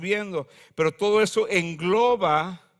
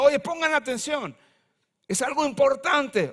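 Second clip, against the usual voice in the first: mock call, pitched up high; wisdom calling out; 7 semitones higher than usual. Wisdom crying out in the streets, a light mock call. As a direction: call the crowd to listen. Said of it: "está gritando".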